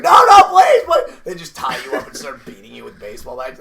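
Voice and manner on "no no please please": high, loud panicked pleading; hammed-up victim's scream; mock terrified begging. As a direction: shriek for mercy, hammed up.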